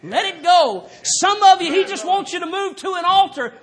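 high, loud, hammering revival preaching; preaching at full force. Acted. Preacher urging the church to let go, shouting. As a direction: drive it home like a tent-meeting preacher, shouting.

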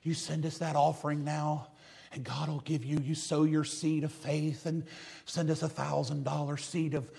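slow, soft, syrupy televangelist plea; TV preacher voice. Retold or imitated. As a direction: coo the offering pitch like a TV preacher. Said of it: "they just know how to talk like this".

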